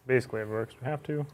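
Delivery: ordinary delivery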